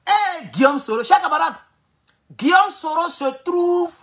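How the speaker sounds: high, stretched-out news reveal; dramatic announcement. Real, not acted. Streamer reveals news to his comrades, high drawn-out announcement. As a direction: draw out the headline on a high pitch.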